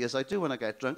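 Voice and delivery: ordinary talk, nothing marked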